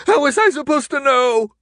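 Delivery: very high, loud wailing protest; desperate wail. A narrator's reading. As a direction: wail it out pleadingly. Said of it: "the man wailed".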